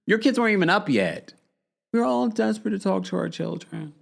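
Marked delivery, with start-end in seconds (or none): 1.9-3.9 higher, hammed-up mock plea; mocking theatrical quote. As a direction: exaggerate the dramatic line even more. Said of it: "Girl, shut up".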